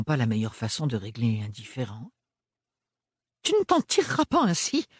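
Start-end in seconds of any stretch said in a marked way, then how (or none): 3.4-4.9 much higher, pointed warning; hissed threat. A narrator's reading. Mother warning Ophélie, high hissed threat. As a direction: threaten her with a raised finger. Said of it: "siffla la mère d'Ophélie".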